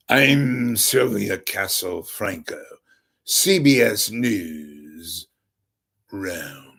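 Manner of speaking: slow, drawn-out news correspondent sign-off; Sylvia Castle Franco. Retold or imitated. CBS correspondent signs off from Rome, slow affected delivery. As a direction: stretch out every word like a self-important reporter. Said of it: "like that correspondent for C B S".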